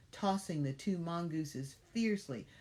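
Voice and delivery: plain reading voice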